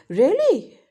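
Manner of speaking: a loud, high gasp of "Really?"; surprised really. Shown as a demonstration. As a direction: blurt "Really?" in shock. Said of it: "That's a surprise".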